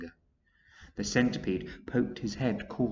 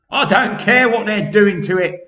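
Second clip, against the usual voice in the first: much higher, loud dismissive; centipede scoffing loud; 11 semitones higher, 14 dB louder than usual. Acted. Centipede leaving friends, loud high scoff. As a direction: scoff and make for the tunnel. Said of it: "the centipede said".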